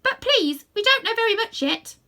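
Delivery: high, loud snorty horse voice; nosy snorty voice. A narrator's reading. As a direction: snort it out like a timid cab horse. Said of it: "said a nosy and snorty kind of voice".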